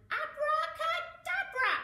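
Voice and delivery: high, loud, slow abracadabra; magic spell. Acted. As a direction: cast the spell with a big flourish. Casting a spell.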